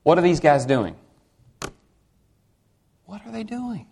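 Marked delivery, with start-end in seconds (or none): 3.1-3.9 much softer, higher-pitched aside; hushed question. Real, not acted. Preacher asking congregation about the men at the cross, hushed. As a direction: drop to a tiny, high, hushed voice.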